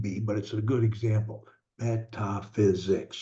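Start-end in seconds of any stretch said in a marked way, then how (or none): 1.8-3.2 "Metaphysics" slowly in four even syllables. Shown as a demonstration. Coach counts out the syllables, "Metaphysics" slow and separated. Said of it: "four, four syllables".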